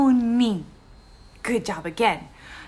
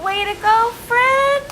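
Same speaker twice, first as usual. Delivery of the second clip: high, loud cheer; cheering praise; 11 semitones higher, 5 dB louder than usual. Real, not acted. Teacher cheering the child for saying peel right.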